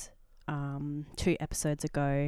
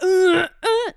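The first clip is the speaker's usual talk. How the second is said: moan imitation; 12 dB louder than usual. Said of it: "It was like".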